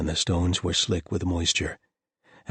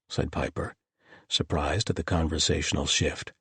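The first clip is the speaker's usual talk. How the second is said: plain reading voice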